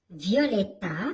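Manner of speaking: ordinary delivery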